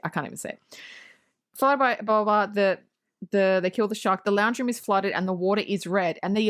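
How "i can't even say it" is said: whispered aside. Real, not acted. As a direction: breathe it out unvoiced.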